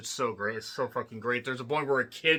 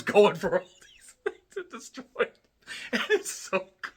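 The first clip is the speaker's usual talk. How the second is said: words squeezed out through high laughter; real laughing; 5 semitones higher than usual. Real, not acted. Reviewer recounts the dog wrecking drones, laughing. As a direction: crack up while telling it.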